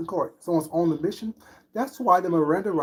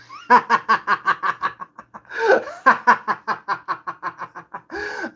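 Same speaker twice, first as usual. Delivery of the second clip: loud laugh; 6 semitones higher, 8 dB louder than usual. Real, not acted. Laughs after parody.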